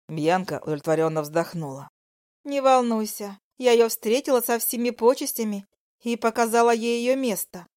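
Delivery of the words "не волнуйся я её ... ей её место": high, smug female voice; smug mistress. Acted. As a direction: gloat like a jealous lover.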